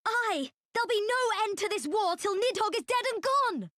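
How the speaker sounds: rallying cry. Acted. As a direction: rouse comrades for war. Soldier rallies comrades against dragon.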